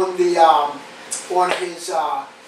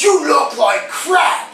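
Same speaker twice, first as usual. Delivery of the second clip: military voice; 7 semitones higher, slower than usual. Retold or imitated. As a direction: stretch it out like a barking drill instructor. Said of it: "military voice goes".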